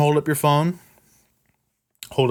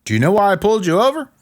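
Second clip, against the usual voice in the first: a higher, louder, quick officer's question; cop's opening line; 8 semitones higher, 4 dB louder, faster than usual. Retold or imitated. Traffic cop questioning the stopped driver, brisk and raised. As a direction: ask it like a cop at the window who has just pulled someone over. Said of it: "Most commonly".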